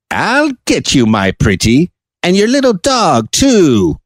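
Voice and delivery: high, swooping witch voice; Wicked Witch. Acted. Host quotes The Wizard of Oz villain, high witchy threat. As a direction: put on a menacing storybook witch, pitch leaping up. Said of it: "The Wicked Witch".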